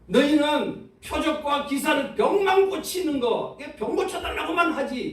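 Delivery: scolding rebuke. Retold or imitated. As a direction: chide them like a fed-up teacher. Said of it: "이런 거 아니에요, 지금 현재?".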